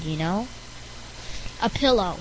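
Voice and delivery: ordinary talk, nothing marked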